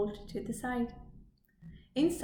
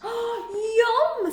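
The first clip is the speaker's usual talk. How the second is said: high, loud, relishing; delighted yum; 13 semitones higher, 8 dB louder than usual. Acted. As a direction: savour the gross muck. Skunk delighted by muck.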